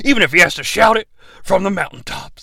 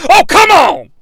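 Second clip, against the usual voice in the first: loud, higher exasperated shout; frustrated yell; 13 semitones higher, 6 dB louder than usual. Acted. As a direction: throw up your hands and yell. Hulk frustrated at speaker.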